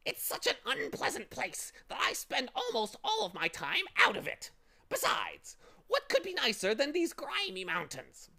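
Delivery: high, squawky bird voice; fussy bird. Acted. Bird explaining to Milo, high squawking complaint.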